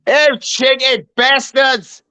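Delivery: high, drawn-out mock English accent; slow, hammed-up English exclamation. Shown as a demonstration. Showing off an English accent to a British listener, cheeky mock-English cry. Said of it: "Here's my English accent".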